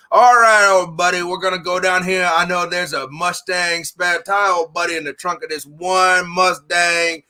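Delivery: lightly put-on southern accent, friendly old-timer; a hint of a Cajun scrapyard owner calling everyone old buddy; Arkansas Louisiana drawl. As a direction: play a good old boy from Louisiana. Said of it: "Arkansas, Louisiana style".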